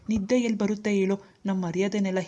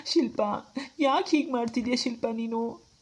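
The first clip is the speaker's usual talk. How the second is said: crying; 6 semitones higher than usual. Acted. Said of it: "ರೀ, ದಯವಿಟ್ಟು ಅಳ್ಬೇಡ್ರಿ".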